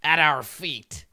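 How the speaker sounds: a kobold king bidding them approach; royal command. Acted. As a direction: grant them audience grandly. King demands offering.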